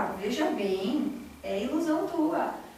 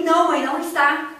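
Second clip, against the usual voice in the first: loud, sharp retort, pitched up; snapping at mother; 4 semitones higher, 8 dB louder than usual. Retold or imitated. Daughter snaps loudly at her mother over the lost phone. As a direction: bite back hard at mom. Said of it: "eu falei muito agressivamente com ela".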